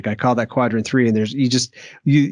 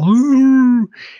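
burst of laughter; 12 semitones higher, 7 dB louder than usual. Real, not acted. Laughing at the hound story.

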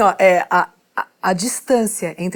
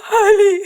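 put-on childlike sob; 10 semitones higher, 6 dB louder than usual. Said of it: "Era eu essa".

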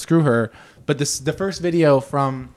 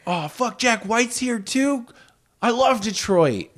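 drawn-out starstruck gushing; excited; 9 semitones higher, slower than usual. Pretend. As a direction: gush like a starstruck fan. Mock fan excitement over celebrities.

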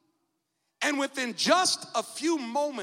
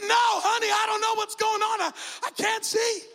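high, loud, frightened answer; panicked shout; 10 semitones higher, 5 dB louder than usual. Retold or imitated. Husband answering his wife while blinded, high panicked shout. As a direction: shout back helplessly, blinded by fog.

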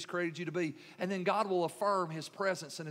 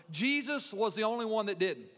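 ordinary delivery

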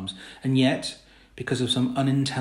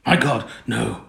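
"My God, no" louder, with horrified insistence; extraordinary emphasis; 6 dB louder, slower than usual. A narrator's reading. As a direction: lean hard on "My God", then "no". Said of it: "with extraordinary emph-, emphasis".

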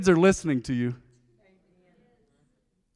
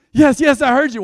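loud, higher, impatient comeback; exasperated kid; 7 semitones higher, 8 dB louder than usual. Acted. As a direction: act out a kid fed up with being asked. Acted kid answering nagging parents.

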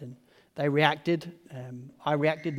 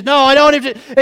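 high, loud retort; heated arguing; 7 semitones higher, 15 dB louder than usual. Retold or imitated. Mum quarrelling with dad over kid, heated arguing. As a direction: fire back as the mock mum.

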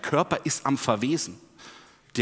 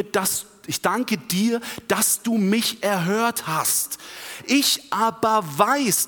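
higher, slow, emphatic prayer; trusting child to father; 6 semitones higher, 4 dB louder, slower than usual. Acted. Jesus thanks his Father at the grave, wholly trusting prayer. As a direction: pray aloud with complete, childlike trust. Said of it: "wie ein Kind zu seinem Vater, im völligen Vertrauen".

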